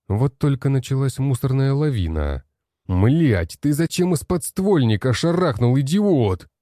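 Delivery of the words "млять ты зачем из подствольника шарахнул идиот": angry outburst at a teammate; swearing. Acted. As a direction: snap at him furiously. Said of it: "выругался блондин".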